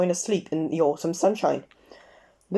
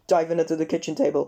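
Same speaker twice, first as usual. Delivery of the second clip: ordinary delivery, nothing marked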